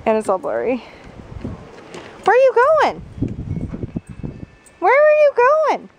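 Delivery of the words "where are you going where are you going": high, loud call after the puppy. Real, not acted. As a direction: call the wandering dog in a squeaky voice. Calling after the dog.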